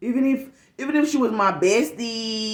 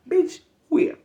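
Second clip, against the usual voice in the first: incredulous; 9 semitones higher than usual. Retold or imitated. Incredulous retort to coworker.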